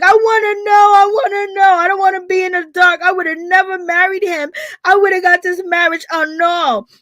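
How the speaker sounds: exaggerated fake sobbing plea; mock crying. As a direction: ham up a dramatic plea for truth. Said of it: "she's just crying up a storm".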